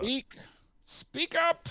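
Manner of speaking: high, sharp call to speak up; loud. Shown as a demonstration. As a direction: shout it up high. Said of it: "it's called vocal fry".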